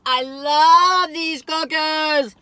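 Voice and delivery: loud, very slow declaration; gushing. Real, not acted. Declaring love for cookies to viewers, loud slow gush. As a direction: draw it out with delight.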